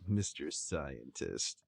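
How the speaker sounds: low, slow, quiet; low ominous. Acted. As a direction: drop low and draw it out. Names villain in low voice.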